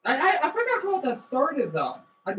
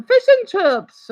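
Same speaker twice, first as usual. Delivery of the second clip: slow, loud mock British accent, a touch higher; a hammed-up British accent; 8 semitones higher, 11 dB louder, slower than usual. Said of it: "a British voice like that".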